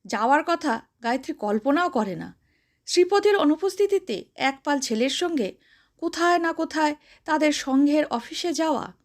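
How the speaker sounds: plain reading voice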